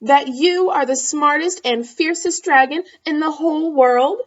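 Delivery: put-on eager girl voice. Elizabeth flatters dragon in story.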